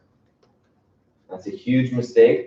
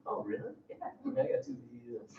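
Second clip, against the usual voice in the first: higher, softer, sheepish admission; sheepish kid, softer; 11 semitones higher, 22 dB quieter than usual. Retold or imitated. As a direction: slip the bad news in quietly, higher. Kid confessing to dad.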